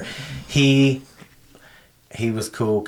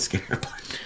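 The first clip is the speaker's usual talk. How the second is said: whisper; 10 dB quieter, much faster than usual. Real, not acted. Laughing aside.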